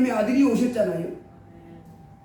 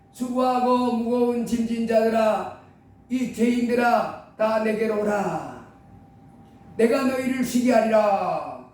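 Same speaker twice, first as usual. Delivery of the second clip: slow, solemn invitation; solemn call of Jesus; slower than usual. Acted. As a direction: call gently and slowly to the weary. (예수님이 죄인들을 부르는 느리고 장엄한 말투)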